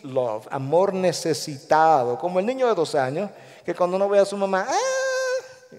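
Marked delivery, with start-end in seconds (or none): 4.7-5.7 imitated toddler scream. Two-year-old who wants his mother, mock screaming. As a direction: imitate a small child crying out.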